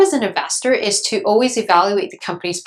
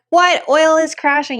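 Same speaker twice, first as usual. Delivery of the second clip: mock panic, higher and louder; shocked outcry; 8 semitones higher, 4 dB louder than usual. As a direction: play a startled investor blurting out the news. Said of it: "Or you're like".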